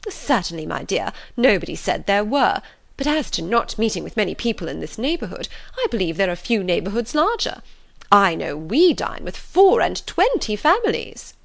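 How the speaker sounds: emphatic, swooping mother voice; huffy, boastful mother. Acted. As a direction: defend the neighbourhood with self-importance. Mrs Bennet defends her neighbourhood.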